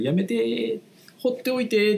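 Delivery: high pleading inner voice; inner plea. Retold or imitated. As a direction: beg to be left alone. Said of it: "って感じで".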